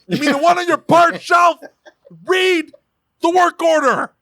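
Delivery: high-pitched exasperated shout; yelling. Acted. Boss to technician who ignored the work order, yelling. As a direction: yell it at a hopeless employee. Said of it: "That's yelling".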